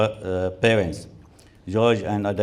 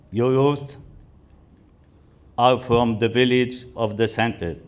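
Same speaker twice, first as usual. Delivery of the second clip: ordinary delivery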